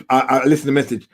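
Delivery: ordinary delivery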